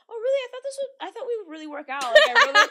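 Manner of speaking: high-pitched, loud mock dismay; exaggerated squeaky "oh, really?" reaction; mock surprised date. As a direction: imitate a let-down date, voice shooting up. Mimics rejected date's reaction.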